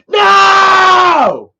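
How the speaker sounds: long, high, loud wail of refusal; imitated mad outburst. Imitating Dad upset at the surprise, mad drawn-out shout. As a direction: yell one drawn-out word at full volume, imitating him. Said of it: "he was mad".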